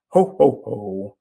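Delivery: booming jingle "Ho, ho, ho" that drops away; loud giant's call from the ad; Green Giant ho ho ho. Shown as a demonstration. Coach showing how tone changes meaning, Green Giant laugh. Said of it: "associate that with an old commercial called Green Giant".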